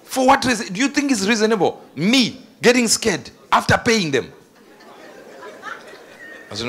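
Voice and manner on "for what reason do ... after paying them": high, loud, disbelieving questions; why on earth pay to be scared; incredulous outburst. Real, not acted. Preacher asking the congregation in loud disbelief.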